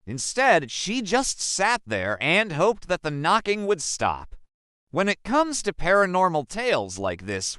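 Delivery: ordinary talk, nothing marked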